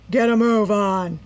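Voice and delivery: gruff gang leader growling orders; growled. A narrator's reading. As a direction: snarl the order at the crew. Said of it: "he growled".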